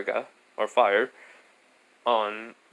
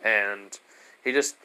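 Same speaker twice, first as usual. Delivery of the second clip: ordinary delivery, nothing marked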